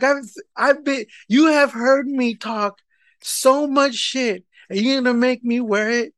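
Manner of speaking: high, indignant complaint; exasperated protest. Retold or imitated. Husband objecting to being made to wear the shirt, exasperated.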